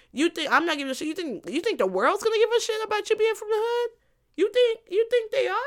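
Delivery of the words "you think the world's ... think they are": high-pitched incredulous questioning; scornful disbelief. Real, not acted. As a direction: pitch it high, scoffing at the very idea. Mocking disbelief at the braggart.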